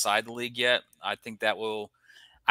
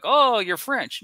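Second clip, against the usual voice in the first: mock fan taunt; 11 semitones higher, 7 dB louder, slower than usual. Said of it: "And people are like".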